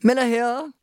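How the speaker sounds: a hint of businesslike phone voice; manager answering phone. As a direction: answer the office phone by surname. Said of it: "I spoke to him and he said".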